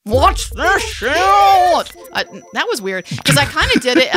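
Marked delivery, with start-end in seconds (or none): 0.0-2.2 high, slow, drawn-out rasping rock wail. Pretend. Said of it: "but then it kinda got [name] right at the end".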